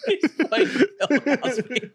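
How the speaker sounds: giggling through the words; laughing high. Real, not acted. Laughing at the Cosby joke.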